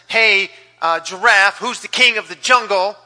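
loud, brash bully of a lion; lion barking at the giraffe; bossy lion. Acted. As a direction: demand it like the king of the jungle. Lion bullies giraffe in story.

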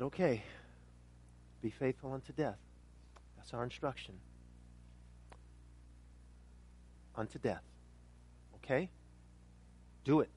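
low, soft acceptance with long pauses; quiet resolve. Acted. Believers look at one another and agree, quiet resolve.

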